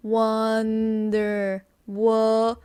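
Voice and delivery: long WONDER, then WO; slow, stopped short. Shown as a demonstration. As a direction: WONDER drawn out slowly, then WO alone, held open. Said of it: "Stop it there".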